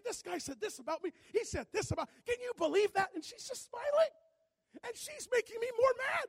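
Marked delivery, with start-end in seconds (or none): 0.0-3.1 higher, louder, fast complaining; worked-up complaint. Retold or imitated. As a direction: vent to the wife, outraged. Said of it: "I go over the whole thing again".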